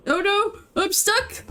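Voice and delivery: startled. Real, not acted. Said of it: "I got caught up in my wires".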